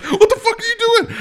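high-pitched outburst through laughter; cracking up, shrill mock outrage; mock incredulous yell. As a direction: yell it in disbelief while laughing. Acted reaction to wrestling move.

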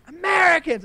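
crowd answer. Retold or imitated. Imitating the rally crowd, high answering shout. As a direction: answer the preacher as the whole crowd.

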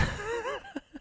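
high, breathy burst of laughter at the slip; surprised laugh. Real, not acted. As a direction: crack up at your own mistake.

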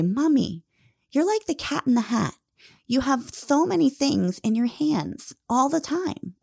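a hint of a small child's voice; little boy. Five-year-old son telling mommy, lightly childlike. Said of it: "my little boy who is five".

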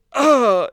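loud high groan of disgust. Retold or imitated. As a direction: groan out loud at the botched kill. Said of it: "and I'm like".

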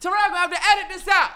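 high, loud indignant protest; real yelling. Real, not acted. Podcaster argues back about mocking accents, yelling. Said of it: "Now I'm yelling".

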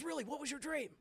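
lightly voiced eager question; surprised. Preacher voicing the stranger's surprised reply. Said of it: "And he goes".

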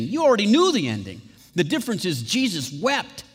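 rising sermon fervor; preaching fervor. Real, not acted. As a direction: press the point home to the congregation. Preacher building to climax.

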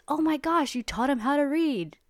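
hammed-up dad caught off guard; shocked dad. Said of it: "And the dad goes".

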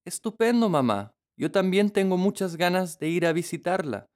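put-on high, joyful girl's voice; excited little girl. A narrator's reading. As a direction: bounce with delight like a child. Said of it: "dijo Caperucita, saltando de alegría". (niña alegre y emocionada)

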